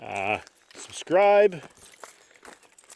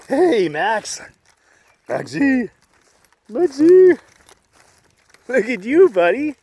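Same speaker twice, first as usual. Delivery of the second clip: dog talk; 12 semitones higher, 9 dB louder, slower than usual. Real, not acted. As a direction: coo at the dog, high and loud. Talking to his dog.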